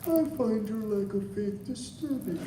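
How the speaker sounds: Vader line in a high Pooh voice; Darth Vader in Winnie the Pooh voice. Retold or imitated. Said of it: "did Darth Vader lines in the Winnie the Pooh voice".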